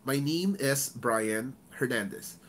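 careful, unhurried name; properly pronounced name. Shown as a demonstration. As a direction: give "Brian" and "Hernandez" each their own space. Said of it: "you have to pronounce it properly".

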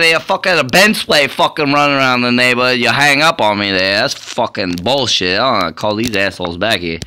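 loud swearing tirade at the top of his lungs; furious shouting. Acted. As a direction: bellow at the official who hung up on you. Caller rages after being hung up on.

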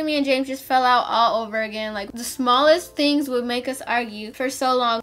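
plain reading voice

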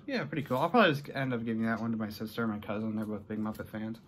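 normal talking voice

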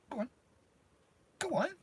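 ordinary delivery

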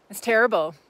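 ordinary delivery

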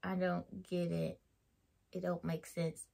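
slow, low, fading to very soft; quiet, drawn-out disbelief; deadpan bafflement. Retold or imitated. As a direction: say it low and halting, utterly baffled. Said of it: "I was like".

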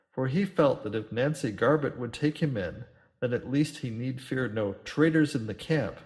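ordinary delivery, nothing marked

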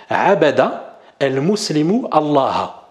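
«Abada el muslimu Allah» said slowly, each vowel clear, the final u of «muslimu» marked; slow Arabic demo. Shown as a demonstration. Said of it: "et on va dire". (prononciation lente et appliquée de «Abada el muslimu Allah»)

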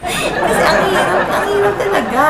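high, loud words through laughter; real laughing complaint. Real, not acted. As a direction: laugh helplessly while complaining. Laughs about her stressful outing.

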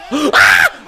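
sharp gasp, then loud ah; gasp, then cry out at the hit; gasp and yell. Real, not acted. Gamer struck in the head, gasp and yell.

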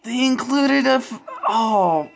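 very high, loud delighted shriek; shrieking jackpot winner. Real, not acted. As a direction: scream with joy like winning big. Finds a wished-for mini pin.

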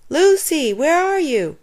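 high, loud call; shouted back. A narrator's reading. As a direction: shout it back to someone out of sight. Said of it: "Bradley shouted back".

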